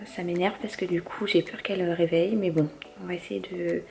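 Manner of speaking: quietly irritated, voice held back; hushed annoyance. Real, not acted. Annoyed, baby asleep next door.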